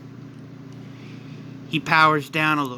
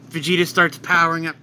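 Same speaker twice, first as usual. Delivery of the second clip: plain reading voice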